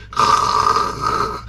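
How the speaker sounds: long, voiceless mock snore; imitated snore. Angler going back to sleep, imitated snore. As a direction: snore softly as if fast asleep.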